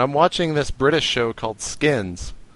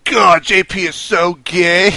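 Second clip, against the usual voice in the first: loud, raised, drawn-out jeer; mocking quote; 5 semitones higher, 5 dB louder, slower than usual. Host imitating Europeans teasing him, loud drawn-out jeer. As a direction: draw it out like a heckling crowd. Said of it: "All the Europeans were like".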